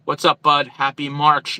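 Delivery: ordinary talk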